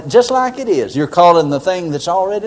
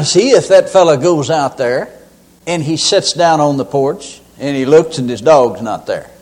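ordinary talk, nothing marked

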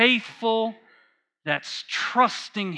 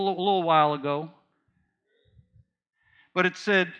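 ordinary delivery

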